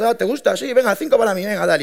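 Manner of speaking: lightly voiced keen classmate; eager student buyer. Friend at university ordering five shirts, eager high voice.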